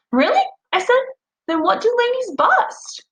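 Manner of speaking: put-on a child genuinely astonished; surprised. A narrator's reading. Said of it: "surprised to hear that".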